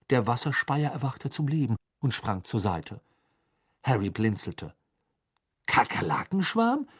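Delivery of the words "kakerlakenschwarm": high, rising, puzzled question; bewildered questioning echo; baffled. A narrator's reading. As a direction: repeat it in disbelief, not shouted. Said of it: "sagte er verdutzt".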